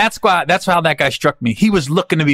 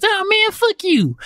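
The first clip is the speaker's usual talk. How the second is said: much higher, slow mock-soft reply; friend's soft voice, faked for laughs; Brad's soft voice; slower than usual. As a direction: drag it out in a high lazy put-on voice. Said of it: "He goes".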